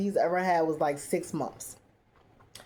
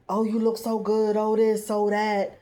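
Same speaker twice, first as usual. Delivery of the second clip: gushing compliments, a bit higher; mock gushing; 3 semitones higher, 3 dB louder than usual. A woman flattering her partner, in a mock gushing voice. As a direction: flatter him, playing her part. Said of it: "Mecca has been".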